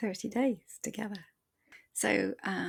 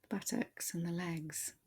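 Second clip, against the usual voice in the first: calm body-scan voice, softer than the intro; guided meditation; 4 dB quieter than usual. Acted. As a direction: ease the listener into their body, gently.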